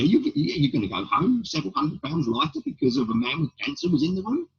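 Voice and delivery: ordinary talk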